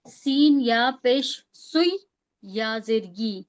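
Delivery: سین یا پیش spelled out slowly; slow spelling. Shown as a demonstration. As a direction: draw out each letter name, slowly. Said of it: "جلدی جلدی نہیں نکلنا ہے".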